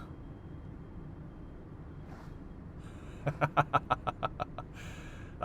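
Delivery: long chuckle after a tease; mischievous giggling laughter; teasing laugh. Acted. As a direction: burst into an amused, drawn-out laugh. Breaks the seductive tease.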